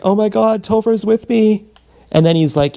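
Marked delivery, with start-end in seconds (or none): 0.0-1.6 put-on high, gushing girl voice; mock excited girl. Slam pig gushing to her friends about Topher, high gushing voice. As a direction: squeal it like a starstruck fan. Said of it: "some slam pig would be like".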